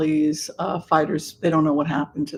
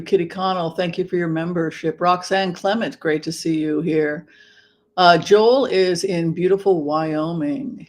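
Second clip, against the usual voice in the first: ordinary talk, nothing marked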